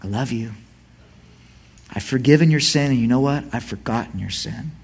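soft, low tender reassurance; quiet tender whisper-like reassurance; gentle voice of God. Retold or imitated. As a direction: speak as a loving father. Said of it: "when I hear God say".

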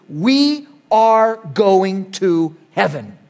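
each word laid down slowly and firmly; slow declaration. Real, not acted. Preacher assuring the congregation, slow and firm. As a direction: let it land word by word.

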